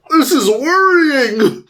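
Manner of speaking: high, drawn-out fretting; mock alarm. Everyone fretting over Tim Allen, high slow mock alarm. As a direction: stretch it out in hammed-up worry. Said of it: "everyone's just like".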